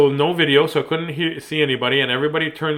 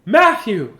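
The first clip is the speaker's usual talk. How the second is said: mother; 8 semitones higher, 7 dB louder than usual. A narrator's reading. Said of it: "said his mother".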